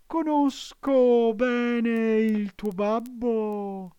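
high, slow fox voice, sweetly cunning; the fox coaxing the puppet; sly fox. A narrator's reading. Fox answers Pinocchio.

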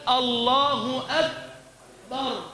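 slow الله أكبر with a stopped, puffless k; dropped hams. Shown as a demonstration. Teacher demonstrates an error, kaf without its hams. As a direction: hold the k of أكبر without any release of air. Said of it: "ترك صفة الهمس".

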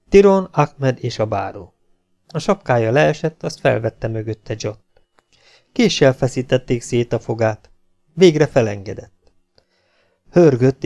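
usual speaking voice

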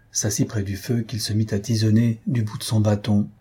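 ordinary delivery

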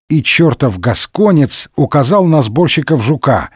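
ordinary delivery

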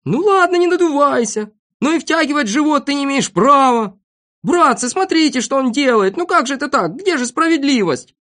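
high, worked-up complaint; loud, high-pitched squabbling; indignant protest. Acted. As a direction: appeal to everyone about the cheating. Acted quarrel, protesting unfairness.

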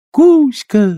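high loud mermaid shout. Acted. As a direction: cry out joyfully. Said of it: "закричали русалки". (радостный крик)

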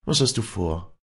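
mildly distressed inquiry; troubled priest. A narrator's reading. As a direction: ask with pastoral concern. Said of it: "sagte der Geistliche bekümmert".

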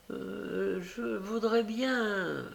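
put-on high, slow, hesitant child's voice; timid stammer. A narrator's reading. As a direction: falter shyly, drawing out the words. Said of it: "balbutia l'enfant". (petite voix aiguë qui balbutie)

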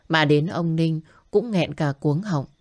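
ordinary delivery, nothing marked

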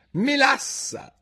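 high, slow drowsy interjection; sleepy voice. A narrator's reading. Dormouse pipes up behind the cook, sleepy. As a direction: stretch the word out drowsily, pitched high. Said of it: "disse una voce sonnacchiosa".

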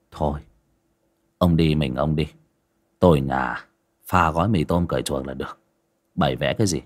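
grumpy master waving off breakfast; grumpy old master. Acted. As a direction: brush off the friend, grumpy and low. Grumpy master voiced in story.

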